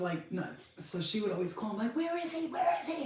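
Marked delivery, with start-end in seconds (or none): 2.0-3.0 high frantic demand; shrill-pitched searching panic; mock frantic wife demanding her husband. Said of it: "she'd flip out".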